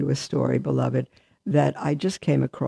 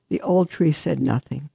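usual speaking voice

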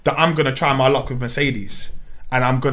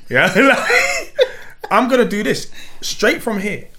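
laughing; 7 semitones higher, slower than usual. Real, not acted. Plotting his plan, laughing.

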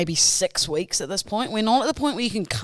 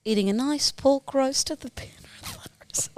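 whisper; 7 semitones higher, 3 dB quieter than usual. Pretend. Teasing put-on whisper.

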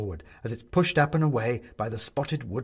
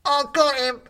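high, loud bubbly shout through water; gurgling underwater yell; 7 dB louder than usual. A narrator's reading. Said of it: "in an underwater voice shouts".

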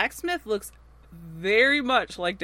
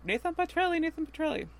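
mock calling out; 10 semitones higher than usual. Hiro runs over to Nathan, mock high-pitched calling. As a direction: imitate someone eagerly calling a name up high.

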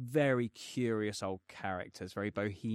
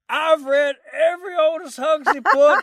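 high, loud, slow mock Southern drawl; mock Southern accent, drunk stranger quizzing him in a pub; drunk Southern American; 13 dB louder, slower than usual. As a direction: ham up the boozy Colonel asking a question. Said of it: "proper Southern".

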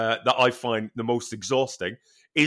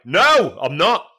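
louder, sharp no; a grumpy no, spat out; curt refusal; 5 semitones higher, 6 dB louder, slower than usual. Retold or imitated. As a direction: snap back at the trainer. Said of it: "Are you all right".

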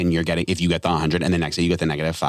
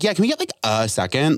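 rude-sounding intonation; 6 semitones higher than usual. Retold or imitated. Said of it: "I, like, intonated wrong".

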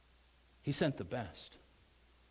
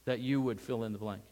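ordinary talk

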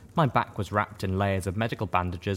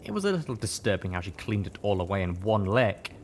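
plain reading voice

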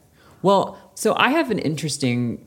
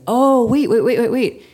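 excited; 9 semitones higher, 6 dB louder than usual. Retold or imitated. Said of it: "I was like".

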